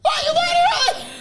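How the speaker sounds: high laughing shout; screaming at him. Real, not acted. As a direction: yell at his sloppy form. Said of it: "You just scream at people".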